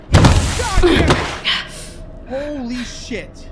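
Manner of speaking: very loud high scared yell; slow, drawn-out frightened shout; scared horror game streamer. Real, not acted.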